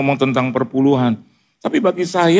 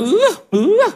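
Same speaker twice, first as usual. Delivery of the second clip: imitated grunts.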